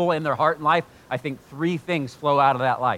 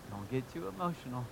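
much softer, mild mock caution; hushed caution; 12 dB quieter than usual. Churchgoer cautioning a worshipper, hushed. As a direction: voice a cautious churchgoer under your breath.

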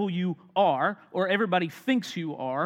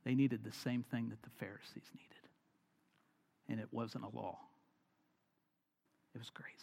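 whispered and much lower, fading to nearly nothing; hushed whisper; 8 semitones lower, 14 dB quieter than usual. Real, not acted. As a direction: lean in and breathe it to the room. Preacher drops to a hush.

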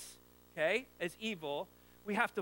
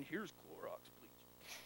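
slow, hushed mock commercial announcer; deep announcer voice; 3 semitones lower, 13 dB quieter, much slower than usual. Pastor recalling an old bleach ad, mock announcer voice. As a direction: lightly imitate the bleach ad man, slow and breathy. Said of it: "the guy had a deep voice like that".

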